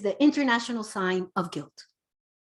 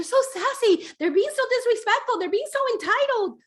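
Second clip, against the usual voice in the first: high, exasperated parent whine; mock complaining parent; 13 semitones higher, 4 dB louder than usual. Parents complaining to the coach, high and exasperated. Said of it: "I love it when parents".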